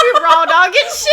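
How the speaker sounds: high, loud through helpless laughter; laughing. Real, not acted. Co-host riffing on the raw dog joke, laughing. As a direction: crack up while getting the joke out.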